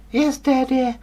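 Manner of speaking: put-on high, loud girlish reply; daughter's voice. Daughter answering the king, high and girlish. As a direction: answer like an eager little girl.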